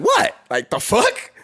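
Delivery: high, startled; mock surprise. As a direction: jump up in pitch, caught off guard. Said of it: "And so I was like".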